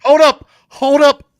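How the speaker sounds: loud, high, startled call to halt; urgent interruption. Acted. Host halts co-hosts over breaking news, urgent and loud. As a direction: break in as if huge news just hit.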